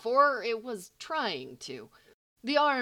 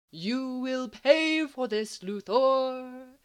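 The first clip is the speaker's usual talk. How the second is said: higher, louder, slow toy-bear sing-song; a cheery storybook bear promising revenge; sing-songed threat; 5 semitones higher, 5 dB louder, much slower than usual. A narrator's reading. Toy bear waggles paw at Lex, in loud slow sing-song. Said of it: "it sing-songed".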